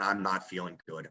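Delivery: weak sick murmur; feeling sick. Retold or imitated. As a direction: let the fever sap the voice. Sick with fever in the snow.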